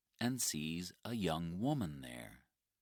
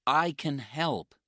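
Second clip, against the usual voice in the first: eager offer; 9 semitones higher, 10 dB louder than usual. Acted. Mike offers help to Jenny.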